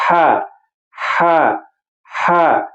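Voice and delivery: ہا breathed from the middle of the throat; Arabic ha from the middle of the throat. Shown as a demonstration. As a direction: push ہا out from the mid throat. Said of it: "یہ وسط حلق والا ہا".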